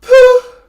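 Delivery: explosive poof from the belly; sharp poof breath. Shown as a demonstration. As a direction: blast a short poof of air. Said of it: "a oo with a P start".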